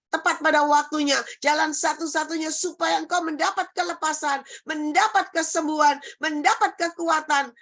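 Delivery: high, forceful, rhythmic proclamation; sermon climax with driving repetition; impassioned preaching. Real, not acted. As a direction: declare it over the congregation, phrase by phrase.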